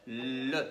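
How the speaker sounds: low, held L at the start of "Le"; sounding out. Shown as a demonstration. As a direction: hum out the L of "Le" on a low note. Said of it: "Qu'est-ce que j'entends en premier".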